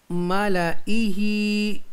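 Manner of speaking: मालाइही slow and evenly drawn out; recitation demo. Shown as a demonstration. Teacher shows students the reading, slow measured मालाइही. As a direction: recite मालाइही syllable by syllable, slowly. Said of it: "ज़ैद अलिफ को नहीं पढ़ेंगे".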